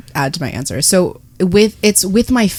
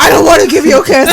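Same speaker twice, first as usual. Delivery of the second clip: high, louder mock sulky refusal; grumpy refusal; 8 semitones higher, 7 dB louder than usual. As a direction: sulk and refuse. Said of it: "He's like".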